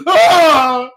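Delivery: high, loud scream of horror; hammed-up shriek; mock scream. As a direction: scream in comic terror. Acts the victim's scream.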